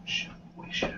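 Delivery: hushed in-character whisper; very soft, voiceless aside. Acted. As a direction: whisper it under your breath. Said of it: "I, I whisper".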